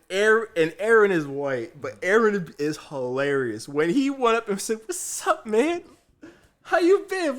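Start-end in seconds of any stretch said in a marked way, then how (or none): 4.9-7.4 put-on high, loud old-buddy act; over-familiar greeting. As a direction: imitate a boss greeting a long-lost pal. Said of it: "You act like you know this man".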